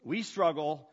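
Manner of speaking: emphatic and loud, easing slightly at the end; forceful preaching. Real, not acted.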